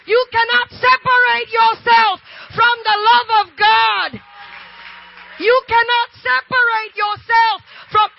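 loud, high preacher's climax; slow, hammering revival shout; full-force preaching. Real, not acted. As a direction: shout the sermon's climax with deliberate, drawn-out emphasis. Preaching climax, voicing God.

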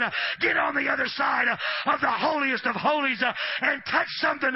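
loud shouted sermon; preaching at full force. Acted. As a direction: preach it hard to the crowd.

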